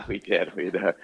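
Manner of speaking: froggy, just-woke-up voice; morning voice. Real, not acted. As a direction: croak it out like you just woke up.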